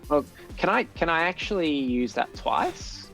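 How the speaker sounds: normal talking voice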